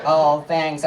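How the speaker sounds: ordinary talk, nothing marked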